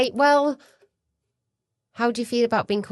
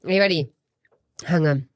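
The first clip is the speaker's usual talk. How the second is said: ordinary talk, nothing marked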